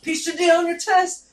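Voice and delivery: high, loud cheery send-off; mock well-wishing. Students wishing a friend luck on test, loud mock cheer. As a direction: call it out like good luck.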